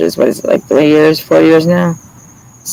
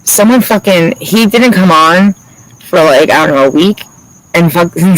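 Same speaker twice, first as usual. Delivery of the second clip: ordinary delivery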